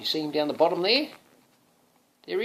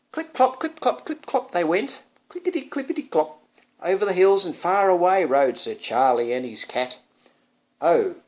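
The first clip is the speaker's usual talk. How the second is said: galloping storybook; 8 dB louder than usual. Acted. Parent reading to son, galloping storybook voice. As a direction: ride along with the horse.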